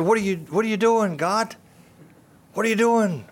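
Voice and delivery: anguished questioning. Retold or imitated.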